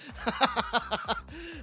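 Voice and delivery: real run of chuckles; wordless giggling fit; amused laugh. Real, not acted. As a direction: keep chuckling to yourself. Laughs about Terry.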